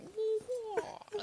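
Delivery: wah wah squawked high; high mock bird cries. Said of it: "another bird just going".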